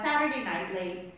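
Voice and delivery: ordinary delivery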